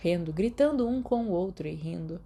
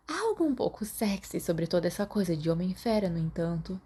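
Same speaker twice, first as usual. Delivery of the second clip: plain reading voice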